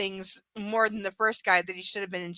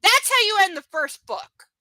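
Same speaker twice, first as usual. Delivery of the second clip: loud, high, triumphant verdict; emphatic; 9 semitones higher, 7 dB louder than usual. Real, not acted. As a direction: slam it home with certainty. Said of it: "emphatic verdict".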